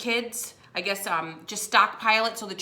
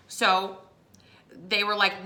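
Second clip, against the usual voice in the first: ordinary delivery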